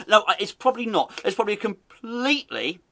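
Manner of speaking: ordinary talk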